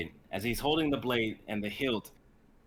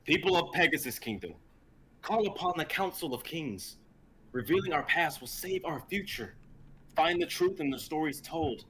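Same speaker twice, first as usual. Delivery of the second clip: higher proclaiming voice; prophetic proclamation; 6 semitones higher than usual. Acted. Zane proclaims to the kingdom, higher prophetic voice. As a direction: declare it down to the kingdom.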